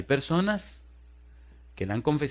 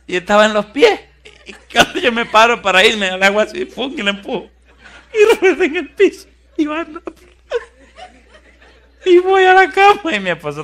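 much higher, louder laugh-filled telling; laughing hard; 11 semitones higher, 10 dB louder than usual. Real, not acted. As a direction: tell it through helpless laughter. Cuenta entre carcajadas la caída. (contando entre carcajadas)

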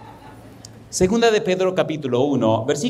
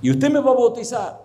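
much higher voice, acting her disbelief; imitated incredulous woman; 10 semitones higher than usual. Woman asking her pastor about baptism, imitated incredulity. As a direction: pitch it right up as the astonished woman.

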